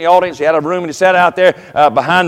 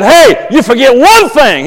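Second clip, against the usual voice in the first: slow, raised-pitch comeback; firm retort; 5 semitones higher, 3 dB louder, slower than usual. Retold or imitated. Pastor to the man screaming at him after service, firm retort. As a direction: push back firmly, drawing out the words.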